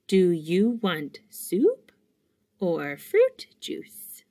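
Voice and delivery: slow, careful dictation; slow deliberate read-aloud. Shown as a demonstration. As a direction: spell it out slowly for learners. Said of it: "reading test sentence slowly".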